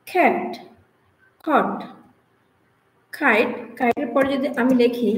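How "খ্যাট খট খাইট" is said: "খ্যাট, খট, খাইট" each opening with a puff; aspirated K. Shown as a demonstration. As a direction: separate words "খ্যাট, খট, খাইট", breathy kh in each. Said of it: "উচ্চারণ হবে". ("খ্যাট, খট, খাইট"-এ খ মহাপ্রাণ)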